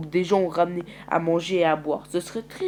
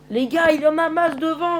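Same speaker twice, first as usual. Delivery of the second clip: alarmed warning; 10 semitones higher, 6 dB louder than usual. Acted. Noah sees zombies ahead.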